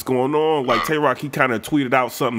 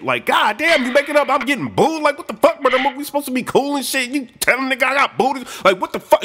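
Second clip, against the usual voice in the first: mock pissed-off rant; 8 semitones higher than usual. [name] complains to [name], high and exasperated. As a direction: vent betrayal at a friend. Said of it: "So [name] is pissed".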